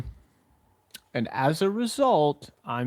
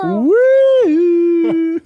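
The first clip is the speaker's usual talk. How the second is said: cheering whoop; 13 semitones higher, 10 dB louder than usual. Pretend. Morning zoo cheer.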